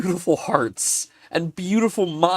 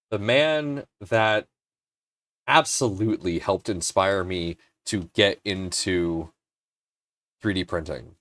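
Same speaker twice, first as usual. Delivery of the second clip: ordinary talk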